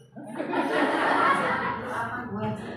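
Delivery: slow, drawn out through laughter; laughing reassurance. Retold or imitated. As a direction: stretch it out amused. (tertawa sambil meyakinkan)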